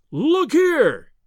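high, loud cry to get attention; excited cry. A narrator's reading. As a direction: shout it out, pointing at the picture. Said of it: "he cried".

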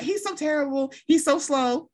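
high mimicked whine; mock complaining. Mimicking complaints about the helper, high mock whine.

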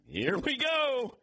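high, drawn-out rallying call; gleeful excitement. Real, not acted. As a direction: kick off a fight with relish. Reacting gleefully to a feud quote.

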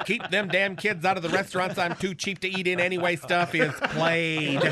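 ordinary delivery

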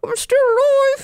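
tiny high squeaky voice. Acted. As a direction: speak like a small trapped creature. Kevin trapped inside, squeaky voice.

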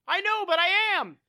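high, loud, quick retort; imitated shouted insistence; insisting. Retold shouted argument with ship.

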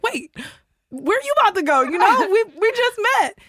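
high-pitched mock protest; acted pleading protest. Retelling her reply as he heads out, high protest.

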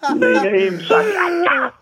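high, slow words through laughter; laughing. Real, not acted. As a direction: barely get it out for laughing. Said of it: "was laughing".

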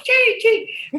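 high playful tchim tchim like a little ball; mock clinking sound; ball clink noise.

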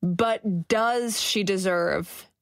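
slow, drawn-out DOES; stress on DOES. Real, not acted. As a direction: lean long and sceptically on "does". Pointed rhetorical doubt, stressing does.

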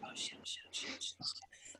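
breathless whisper, barely voiced; stunned whisper. Retelling the producers' call to the hosts, shocked whisper. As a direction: hiss it under your breath in disbelief.

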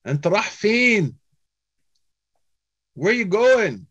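high, quick call out of a car window; friendly call from car. Retold or imitated. Man leaning out of car calls to stranded traveller, high and quick.

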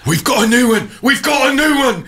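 very high, loud excited cry. Acted. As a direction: squeal it like a giddy prisoner. Excited prisoner greeting newcomer.

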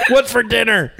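high mock tired homecoming; weary spouse voice. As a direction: play a worn-out spouse home. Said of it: "queef that's, like, had a long day".

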